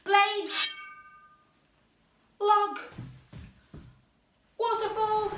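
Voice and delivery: loud high shout of the cue word; sudden excited call-out; called-out command. Acted. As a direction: yell it like a game leader. Calling out game instruction.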